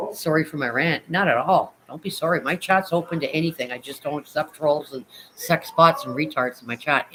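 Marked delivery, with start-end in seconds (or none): none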